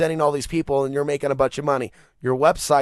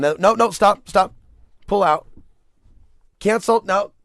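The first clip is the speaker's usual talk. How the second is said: higher, louder panicked no, no, stop; mock panic; 6 semitones higher, 5 dB louder than usual. Reliving stopping the payment, higher louder mock panic. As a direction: yell stop like you caught a mistake.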